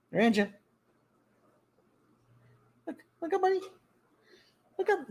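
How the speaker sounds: high sing-song coaxing; coaxing pet talk. Real, not acted. Host coaxes cat to look at camera, high and cooing. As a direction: coax the cat up in a high coo.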